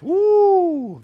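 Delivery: long, loud high-pitched "woo"; high whoop. Real, not acted. Preacher introducing the lust of the eyes, whooping loudly. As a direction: let out a big drawn-out whoop.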